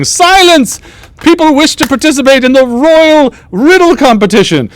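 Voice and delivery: high-pitched court jester proclamation; theatrical, high herald-like jester; jester announcing. Acted. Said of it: "the jester ... and then says".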